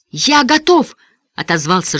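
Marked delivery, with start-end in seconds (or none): 0.1-1.0 high, louder, determined reply; through gritted teeth. A narrator's reading. Davy Ray answering that he is ready, gritted-teeth determination. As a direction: force it out with resolve. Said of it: "сжав зубы".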